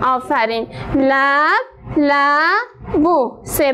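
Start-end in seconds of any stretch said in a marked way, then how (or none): 0.9-3.4 لبلبو split slowly as لب - لبو; syllabified slowly. Shown as a demonstration. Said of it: "چند بخش شد". (هجا کردن آهسته لبلبو)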